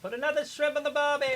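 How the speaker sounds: high, loud, fast hammed-up Aussie; over-the-top Aussie parody; mock Australian accent. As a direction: bellow a cartoon Aussie cliché. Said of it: "Talk like that".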